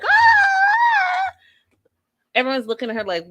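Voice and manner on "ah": a very high, loud mock toddler scream; mock tantrum shriek. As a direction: shriek like a kid who knows they're watched. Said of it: "then it's all like".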